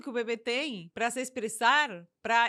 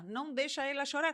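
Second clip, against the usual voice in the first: ordinary delivery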